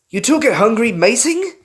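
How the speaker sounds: loud, high leering jab; crude taunt. A narrator's reading. Carlos taunting the pair.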